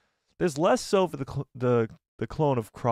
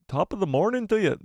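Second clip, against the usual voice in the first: lilting, higher stab at an Irish accent; attempted Irish accent; 6 semitones higher than usual. Shown as a demonstration. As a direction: try out an Irish accent, not quite locked in. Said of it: "I'll do my Irish accent again".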